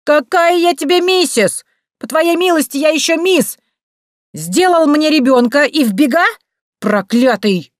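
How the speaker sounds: very high, loud shrieking tirade; furious rant. A narrator's reading. Fake jilted woman berating the doorman, high loud shrieking rant. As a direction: shriek the accusations at him.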